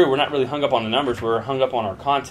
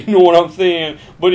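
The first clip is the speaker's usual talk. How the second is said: laughing through it, louder and higher; 7 semitones higher, 9 dB louder than usual. Real, not acted. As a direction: crack up at your own joke. Joke about his Assosaurus.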